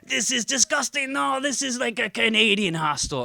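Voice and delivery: put-on high, loud French-accented disgust; mock French accent. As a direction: whine it like a fussy French guest. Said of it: "I can do one accent".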